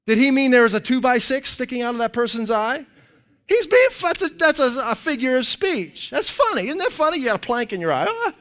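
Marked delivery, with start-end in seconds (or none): 3.5-8.3 high-pitched, tickled delivery breaking into a laugh; chuckling at his own example; amused. Real, not acted. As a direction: stumble over it, close to laughing. Amused at the plank joke.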